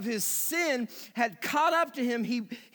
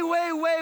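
higher, louder way way; emphatic repetition; 5 semitones higher, 5 dB louder than usual. Acted. Pastor stressing how much more people got, louder and higher. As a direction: stretch each way for size.

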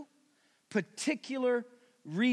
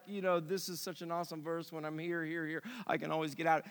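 lower, softer, quick offhand mock tone; mock casual voice; 7 semitones lower, 8 dB quieter, faster than usual. Pastor mocking the congregation's easy reading, softer and quicker. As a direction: toss it off like a careless believer. Mocking what people think.

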